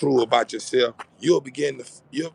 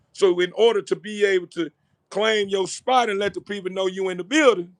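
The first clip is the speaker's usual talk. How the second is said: fired up; 8 semitones higher than usual. Real, not acted. Fired-up motivational run.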